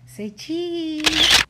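usual speaking voice

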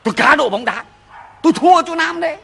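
high, loud yell of pain and protest; pained shout. Acted. Husband yells at the shoving wife, confessing the bet, loud high shout. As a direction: shout him off while being grabbed.

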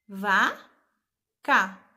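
vá, cá loud and separate; syllables spelled out. Shown as a demonstration. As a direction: punch each syllable. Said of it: "Vamos separar as sílabas?". (sílabas separadas e fortes)

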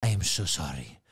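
low, formal apologetic waiter; bow and apologise like a grand waiter; posh waiter voice. Retold or imitated. A Ritz waiter refuses another Wellington, low formal apology. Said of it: "he's like".

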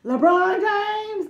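high, slow, drawn-out hype shout; meme shout. Retold or imitated. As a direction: yell the name like the meme gif. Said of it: "Every time I say his name, that comes in my head".

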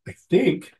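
stuffy nose from a cold; talking through a blocked nose; nasal voice. Real, not acted. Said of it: "my nasally voice from getting over my cold".

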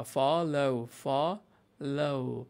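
ফলো drawn out slowly, each sound clear; careful pronunciation. Shown as a demonstration. Teacher modelling ফলো slowly for the class, careful pronunciation. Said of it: "pronunciation খেয়াল করেন".

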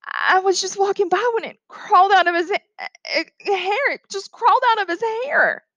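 high, distressed woman's voice; desperate. A narrator's reading. Teacher explains what crawled out of hair, desperate high voice. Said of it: "Miss Caroline said desperately".

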